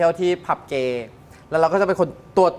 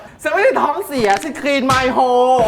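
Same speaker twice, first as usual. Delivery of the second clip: slow, laugh-broken retort; laughing while quoting; 8 semitones higher, 4 dB louder, much slower than usual. Retold or imitated. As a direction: laugh through the words. Retelling own reply, laughing.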